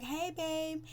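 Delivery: high-pitched put-on "hey babe"; mock sweet greeting. Recapping her greeting to a babe, mock sweet. Voicing Amber's flirty greeting in retelling.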